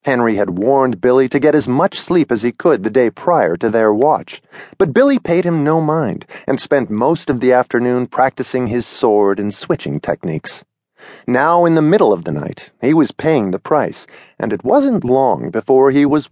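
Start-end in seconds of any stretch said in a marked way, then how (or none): none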